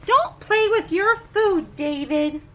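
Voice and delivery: stern loud scolding; scolding parent. Acted. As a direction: tell him off at the dinner table. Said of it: "reading parent's scolding".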